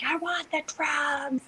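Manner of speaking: high, drawn-out mock wail; mock wailing demand. Labouring woman demanding drugs from the doctor, mock wailing.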